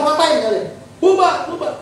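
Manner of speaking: high-pitched challenge to a mob; retold indignant shout; acted confrontation. Acts himself confronting the mob.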